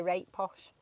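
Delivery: "Rate posh", softly in broad dialect; local dialect pronunciation. Shown as a demonstration. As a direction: say "Rate" with a long Yorkshire 'ay' vowel, for "right". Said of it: "That's what you, now you say".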